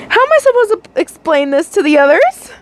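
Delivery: high, anxious whine; anxious complaint. A narrator's reading. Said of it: "you anxiously complained".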